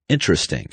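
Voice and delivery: "Interesting" in three syllables, IN-tris-ting; omitted syllable. Shown as a demonstration. Teacher showing omitted syllables, "Interesting" as IN-tris-ting. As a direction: drop the second vowel of "Interesting". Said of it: "Omitted syllables".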